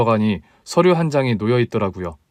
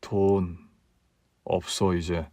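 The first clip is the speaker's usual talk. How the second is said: quiet; 5 semitones lower, 9 dB quieter, much slower than usual. Acted. Husband admits money is gone.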